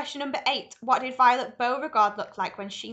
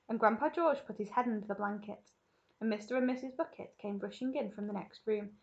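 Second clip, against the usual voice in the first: plain reading voice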